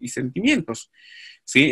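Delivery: usual speaking voice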